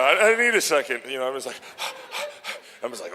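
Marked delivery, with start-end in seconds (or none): 0.0-1.0 loud, high mock plea for a moment; urgent retold plea, pitched up; winded plea. Retelling being winded after the haka. 1.6-2.6 heavy exhale, as if worked up; puffed-out breath after a war dance; acted heavy breathing. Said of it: "my frickin' heart was, like, pounding".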